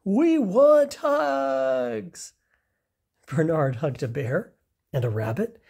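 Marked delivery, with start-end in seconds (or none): 0.0-2.3 much higher, louder, very slow drawn-out chant; eager crowd. Acted. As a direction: animals lining up to demand hugs. Crowd of animals demanding hugs.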